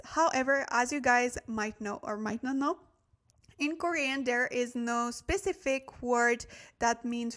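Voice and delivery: ordinary talk, nothing marked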